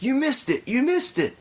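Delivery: very high mock-frantic cry; freaking-out man. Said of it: "he's freaking out".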